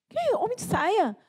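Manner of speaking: high, drawn-out scandalized question; shocked disbelief. Retold or imitated. Mother retelling her reaction to the cartoon, incredulous. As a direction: reenact being appalled, pitch leaping up. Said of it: "Eu fiquei tão desesperada".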